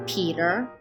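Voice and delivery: usual speaking voice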